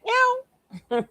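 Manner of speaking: single high-pitched mimicked meow; cat meow. Streamer quotes the cat again for viewers, high meow. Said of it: "my cat like".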